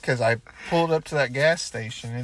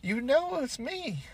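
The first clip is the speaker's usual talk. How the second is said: talking to the dog. Retold or imitated. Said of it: "I'm like".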